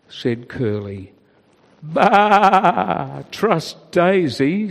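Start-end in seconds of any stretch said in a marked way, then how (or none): 1.8-4.7 grumpy sheep bleat. A narrator's reading. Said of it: "said another sheep".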